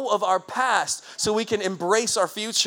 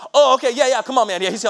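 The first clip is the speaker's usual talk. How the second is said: sudden mock turnaround; 5 semitones higher, 8 dB louder than usual. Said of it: "they're like".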